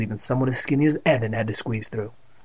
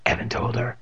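ordinary delivery, nothing marked

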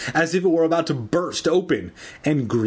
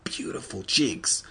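ordinary delivery, nothing marked

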